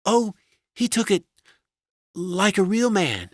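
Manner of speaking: very high, halting wife voice; guarded wife. A narrator's reading. Wife reports to husband, halting.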